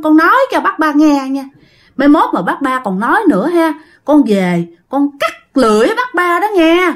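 put-on reproachful ghost girl. Dead girl warning neighbour against slandering her family, reproachful ghost voice. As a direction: scold her as the wronged dead child.

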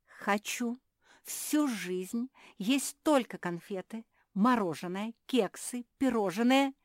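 crying out. A narrator's reading. Pug shouting her wish for all to hear, crying out. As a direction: call out each treat loudly, piece by piece. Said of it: "крикнула мопсиха".

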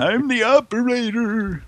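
high-pitched mock old-man voice; really old man. Mocking the operator from the prank call, old man. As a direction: put on an exaggerated elderly operator. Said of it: "like, some really old man".

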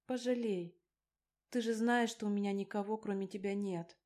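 ordinary delivery, nothing marked

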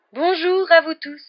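mysterious seer, slow, high, loud greeting; theatrical, otherworldly teacher's welcome; Professor Trelawney. A narrator's reading. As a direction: draw it out in a high, eerie singsong. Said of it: "la voix familière et mystérieuse du professeur Trelawney".

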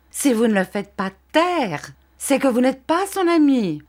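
high, insistent, climbing reproach; urgent reproach. A narrator's reading. Uriah telling Copperfield he is no friend, urgently reproachful.

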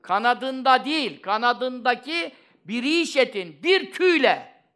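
slow, loud, high-pitched sermon delivery; emphatic preaching. Real, not acted. Preacher marvelling at the size of an angel's wing, emphatic preaching. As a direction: hammer out each phrase to impress the congregation.

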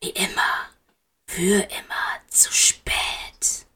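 slow, voiceless whisper. A narrator's reading. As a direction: whisper it slowly into his ear.

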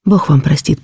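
usual speaking voice

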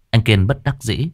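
usual speaking voice

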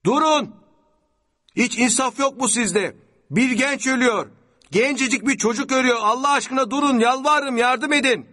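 very high, loud pleading; desperate screaming. A narrator's reading. Sami Bey begs passing car for help, screaming. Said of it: "avaz avaz bağırıyordu".

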